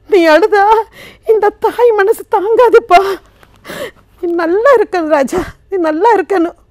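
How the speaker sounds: acted anguish, climbing high and loud; distraught mother. Mother begging her weeping son not to cry, distraught. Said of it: "அந்த தாய் பேசுற மாதிரி கதைச்சேன்".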